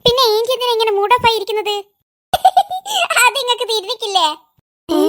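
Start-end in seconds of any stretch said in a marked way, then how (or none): 2.3-3.2 burst of high laughter. Acted. Ikru laughs at aunt.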